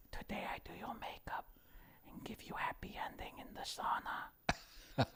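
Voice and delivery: put-on soft whispered Chinese accent, high; Ting Ting ASMR whisper. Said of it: "She goes".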